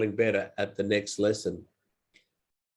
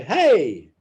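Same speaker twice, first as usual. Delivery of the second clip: casual hey; 3 semitones higher, 4 dB louder, much slower than usual. Shown as a demonstration. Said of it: "you just say".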